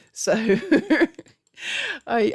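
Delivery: normal talking voice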